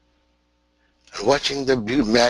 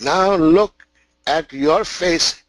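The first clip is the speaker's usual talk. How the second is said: louder, higher, slowed "Now look at your face"; commanding lion; 4 semitones higher, 5 dB louder than usual. Acted. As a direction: order the cub to see himself.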